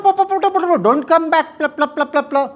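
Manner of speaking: mock grandmother telling you not to come back; imitating an Indian grandmother. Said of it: "play their own Indian grandmother".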